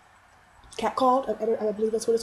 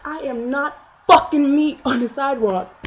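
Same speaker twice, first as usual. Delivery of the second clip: indignant outburst; 5 semitones higher, 5 dB louder than usual. Retold or imitated. Retold confrontation, raised voice.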